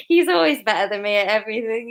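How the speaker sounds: laughing through the words; giggling while she talks. Real, not acted. Laughing at partner's accent.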